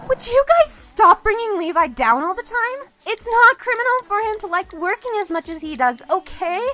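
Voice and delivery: angry outburst. Acted. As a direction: shut down their teasing hard. Defending Levi from teasing friends.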